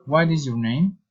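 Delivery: "name" lifts at the end; polite question rising on "name"; friendly rising intonation. Shown as a demonstration. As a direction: let "name" climb with interest. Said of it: "reason, intonation".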